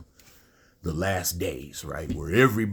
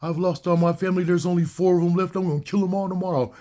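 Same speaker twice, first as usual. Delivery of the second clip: high, mocking voice of a crazy fan; mocking crazy viewer; 11 semitones higher, 3 dB louder, faster than usual. Mocking a crazy viewer who misunderstands him, in a high mock voice. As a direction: lampoon the dumb listener, high and fast at the end.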